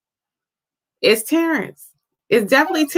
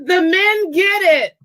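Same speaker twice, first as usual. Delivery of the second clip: excited shout; 8 semitones higher, slower than usual. Real, not acted. Cheering the men's correct answer.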